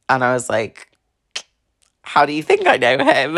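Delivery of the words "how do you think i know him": high, incredulous retort; exasperated retort. Retold or imitated. To her date, a high exasperated comeback. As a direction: toss the question back, exasperated.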